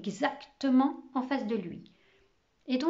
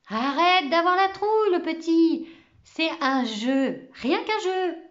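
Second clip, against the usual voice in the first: higher-pitched, animated schoolboy; lively reassurance; 6 semitones higher, 3 dB louder than usual. A narrator's reading. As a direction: insist to a younger friend it's harmless. Camo rassure le petit en récréation. (rassurant et animé)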